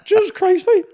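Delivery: very high squeaky scared voice, slowed; high-pitched mock-frightened squeak. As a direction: squeal it out like a terrified soldier.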